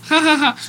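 high, cackling northern-style laugh; mock northern laugh. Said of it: "se ríe norteña".

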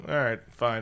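usual speaking voice

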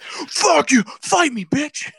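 mock drunken shouting; drunk yelling. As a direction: pick a fight like a drunk on Four Loko. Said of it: "You're just like".

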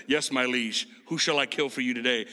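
low, solemn soldier at attention; Roman centurion. Acted. Centurion reporting to his liege, low mock-solemn voice. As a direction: pledge service in a deep mock-martial voice. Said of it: "Like I'm some Roman centurion".

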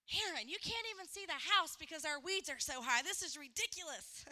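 hammed-up nag about the weeds; nagging demand. Wife scolding Aaron about the weeds, mock exasperated and loud. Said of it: "But if I'm like".

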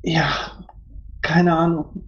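usual speaking voice

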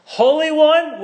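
ringing Holy One; exalted call. Acted. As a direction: proclaim it with awe. God quoted with awe.

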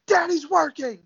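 high, hammed-up dad shushing the kid; mock stern dad. Host jokes dad shushes toddler, mock stern. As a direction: play the busy father waving the kid off.

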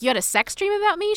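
high, fast, startled disbelief; the hero blurting out his surprise at her dream, startled; shocked question. Retold or imitated. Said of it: "And he's like".

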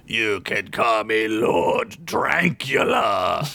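slow, far, menacing vampire lord; dark lord. Acted. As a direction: savour each word like an ancient vampire. Vampire lord introduces himself.

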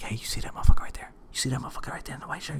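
fast, whispery aside; retold hushed tip-off. Narrator tips off his friend about a man, hushed and quick. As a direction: whisper it urgently to a buddy.

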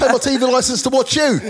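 loud, high, laughing mock complaint; hammed-up aggrieved British punter; British heckler. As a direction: heckle indignantly through laughter. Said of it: "British people are like".

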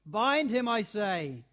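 loud, harsh command. A narrator's reading. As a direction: bark the order.

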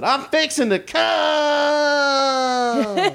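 put-on high, slow, drawn-out Southern accent through laughter. Telling John Waters the joke, in a laughing Southern accent. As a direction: stretch it out in a Southern twang, laughing. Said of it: "I love a Southern accent on a guy".

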